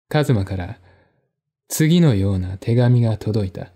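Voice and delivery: plain reading voice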